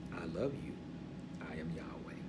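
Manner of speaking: very soft and low; quiet, intimate declaration of love; hushed tenderness. Acted. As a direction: drop to a gentle near-murmur. Soft contrast after emphatic command.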